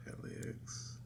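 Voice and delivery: whispered, spelled out; whispering. Retold or imitated. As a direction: breathe the letters out. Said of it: "this is so terrible without rhythm".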